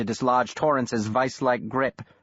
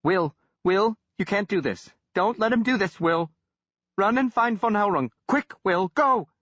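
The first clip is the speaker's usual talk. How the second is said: panicked pleading; 11 semitones higher than usual. Acted. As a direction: beg him to run, voice climbing in panic. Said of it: "Arkwright echoed".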